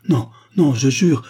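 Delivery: loud, high-pitched protest; desperate, forceful swearing; frantic denial. Acted. Accused man protests his innocence.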